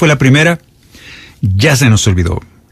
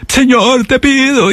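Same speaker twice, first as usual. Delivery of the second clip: mock anguished, much higher cry to God; desperate plea; 13 semitones higher, slower than usual. Preacher imitating a believer's frantic begging, high-pitched mock plea. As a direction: imitate a frantic, pleading prayer. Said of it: "casi llorando, gritando".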